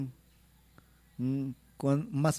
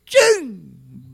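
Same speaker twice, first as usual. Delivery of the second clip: loud final chin; 9 dB louder than usual. Acted. Chant ends with a loud hit.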